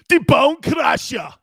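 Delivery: bellowed ring name, slow and big; ring announcer shout. Pretend. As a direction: call out the wrestler's name to the arena. Announcing his wrestling name.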